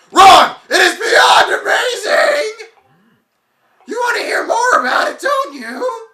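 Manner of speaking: very high, loud shouting; ecstatic shout. Acted. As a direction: scream it with wild glee. NPC shouting with excitement.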